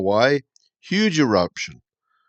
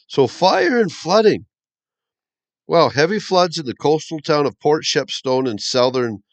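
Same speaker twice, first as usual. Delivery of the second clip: excited news; 5 semitones higher, 4 dB louder than usual. Real, not acted. Excited report of disasters.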